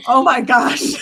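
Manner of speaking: loud, drawn-out exclamation. Real, not acted. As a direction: exclaim it loudly, stretching it out. Reacts to church music joke.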